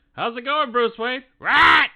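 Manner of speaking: high, loud comic impression; mock skit voice. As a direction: ham up the skit line. Quoting a favorite skit.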